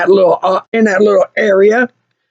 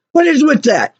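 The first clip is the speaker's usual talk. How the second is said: higher, incredulous question; exasperated; 4 semitones higher than usual. Real, not acted. Customer venting about garage workers, exasperated. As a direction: ask it in indignant disbelief. Exasperated rant, first take.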